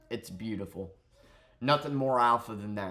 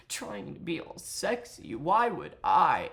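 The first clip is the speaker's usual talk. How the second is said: higher, exaggerated sultry mimicry; mock sexy voice; 6 semitones higher than usual. Streamer mocking the apology video delivery, put-on sexy. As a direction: imitate someone trying too hard to seduce. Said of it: "when you're talking like this".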